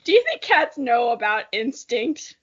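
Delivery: usual speaking voice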